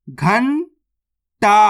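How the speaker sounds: drawn-out syllables, spelled slowly; slowly sounded out. Shown as a demonstration. Teacher spelling the word aloud for pupils, slow. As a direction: stretch each sound of घंटा slowly. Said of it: "तो मिलके बन जाएगा".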